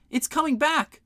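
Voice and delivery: panicked high shout. A narrator's reading. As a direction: shout in alarm. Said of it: "shouted Isadora".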